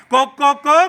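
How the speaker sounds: rhythmic imitation of a rooster's call; lightly voiced rooster refrain; crying rooster. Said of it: "combo रडटा".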